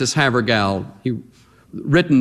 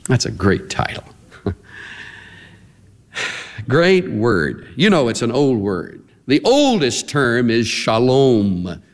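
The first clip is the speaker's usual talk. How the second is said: ordinary talk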